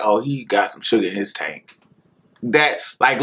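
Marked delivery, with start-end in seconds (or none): 0.0-1.8 lower, softer snide aside; snide gossip. Retold or imitated. Neighborhood men gossiping about the boy, softer snide aside. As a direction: murmur it like a sly neighborhood jab. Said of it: "They would be like".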